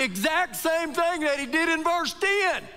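high, driving preacher's delivery; fervent preaching. Acted. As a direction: push it like a pulpit appeal. Preaching at full force.

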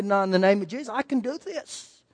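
steady yourself in a near-murmur; quiet self-talk. Retold or imitated. Pastor bracing himself against panic, softly determined. Telling himself in confined space.

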